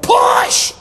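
loud shouted command; yell. Retold or imitated. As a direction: bellow the order like delivery-room staff. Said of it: "Somebody yelled".

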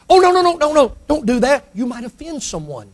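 high flurry fading to hushed caution; mock fussy objection. Church folk stopping a seeker, fussy hushing.

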